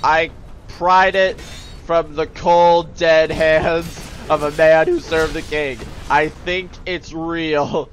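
slow, loud, chopped phrases; mock-dramatic insistence; emphatic retort. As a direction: hammer each phrase out to prove it. Acted retort to soldier.

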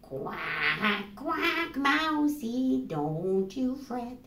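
slow sung duck lullaby with quacks; quacking duck lullaby. Acted. Missus Duck sings the baby mouse to sleep, slow quacky lullaby. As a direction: croon it like a mother duck. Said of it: "sang a lullaby that went like this".

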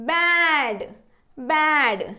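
"బ్యాడ్" with long vowel, falling pitch; pronunciation demo. Shown as a demonstration. Teacher modelling how it is read, "బ్యాడ్" drawn out. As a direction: stretch "బ్యాడ్" as one blended word. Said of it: "ఎలా పలుకుతుంది".

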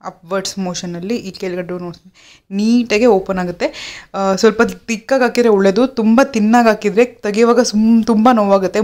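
fingers tugging at lips; speaking through stiff face film; peeling mask off lips. Real, not acted.

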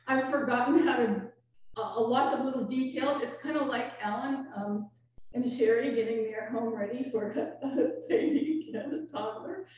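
ordinary talk, nothing marked

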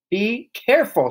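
high, sharp shriek. A narrator's reading. Mother as powder spills on the jacket, shrieking. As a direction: shriek a sudden warning. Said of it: "shrieked the mother".

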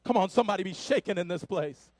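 rousing Pentecostal sermon voice; full-force preaching. Acted. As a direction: preach it to the rafters, calling the church to answer.

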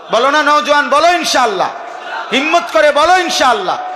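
rally the crowd to say it aloud; rousing call. Real, not acted. Preacher urging the young men to answer, rousing shout.

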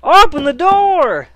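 slow, loud, high bellow; demanding shout. Pretend. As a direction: hammer on the door with your voice. Pounding on game door.

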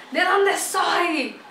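slow, drawn-out playful question; teasing question. Real, not acted. Asking viewers to guess her home country, slow teasing question. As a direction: stretch it like a guessing game. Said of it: "teasing quiz question".

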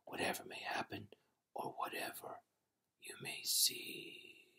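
breathless whisper, fading out; dying whisper. A narrator's reading. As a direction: hiss the last words, dying. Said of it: "cried the dying man".

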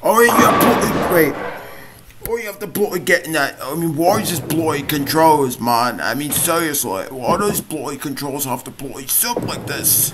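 high-pitched put-on British accent, exasperated; whiny mock-British complaint; mock British rant. Acted accented rant at the bowling controls.

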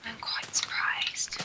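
breathy slow whisper. Real, not acted. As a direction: whisper it aside. Whispered aside.

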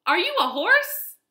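high, loud friendly howdy; cheerful cowboy greeting. Acted. Cowboy greeting a wagon, high and loud. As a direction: call out like an eager young cowboy. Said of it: "he asked".

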